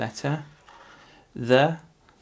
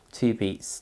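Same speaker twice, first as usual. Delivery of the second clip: ordinary delivery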